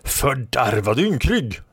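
slow, high-pitched insult; scornful retort. A narrator's reading. Red Lady answers the knight's attack, slow scornful retort. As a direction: draw out the insult with disdain. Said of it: "svarade Röda Damen".

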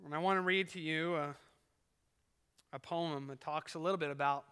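ordinary talk

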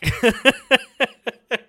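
squeaky high cackle in character; a hammed-up giggle; high mock laugh. Mechanic laughing at the crack, high squeak.

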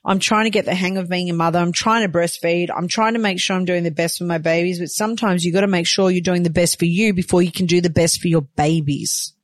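usual speaking voice

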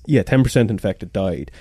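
normal talking voice

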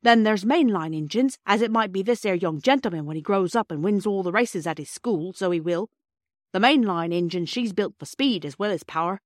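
put-on a proud cockney porter lecturing children. Porter Perks explaining engines to the children in a hearty Cockney accent.

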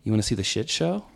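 soft, low, calm offer; calm, quiet invite. Retold or imitated. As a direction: ask it casually, quietly. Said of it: "very calm".

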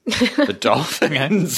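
high, slow laughing dolphin squeak; chirpy clicking dolphin. Acted. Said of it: "best dolphin voice".

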